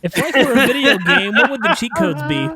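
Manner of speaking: laughing at own question. Real, not acted. Laughing while asking.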